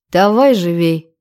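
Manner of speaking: ordinary delivery, nothing marked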